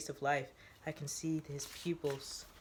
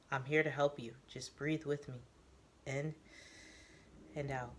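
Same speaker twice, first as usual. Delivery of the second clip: softer, soothing and reassuring; calming; 5 dB quieter than usual. Acted. Newbie calms the armed Teo, soft and soothing. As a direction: talk him down gently. Newbie guiding Teo's breathing.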